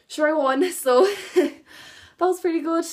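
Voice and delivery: a real laugh breaking into a modest brag. Real, not acted. Laughs about her own win.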